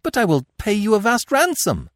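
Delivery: high, loud, urgent bargaining; frantic royal offer; desperate plea. A narrator's reading. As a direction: plead with your captor at high pitch. Captive queen pleads with her captor.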